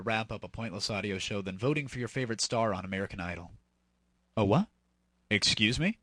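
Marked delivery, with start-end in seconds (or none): none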